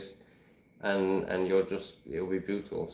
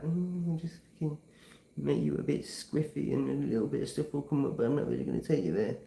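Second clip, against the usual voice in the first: a lazy, shrugging voice for the plant, a bit softer; voicing San Pedro; 4 semitones higher, 6 dB quieter than usual. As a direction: talk as the lazy plant medicine, half-hearted. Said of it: "San Pedro was just like".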